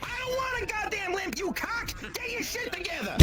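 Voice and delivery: put-on Jersey accent. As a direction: rant like a hot-headed New Jersey guy. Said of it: "'Cause I'm bringing out my Jersey accent".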